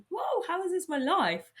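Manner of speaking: whoa, like you can't believe your luck; amazed disbelief. Retold or imitated. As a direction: jump up high in happy wonder. Marvelling at her own life.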